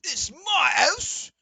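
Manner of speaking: high, loud, very slow indignant yell. Acted. Downed Kenku defends his home, slow loud yell. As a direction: drag it out in outrage. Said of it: "he goes".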